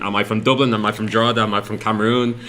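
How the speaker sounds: mock code switching. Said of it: "have to code switch between".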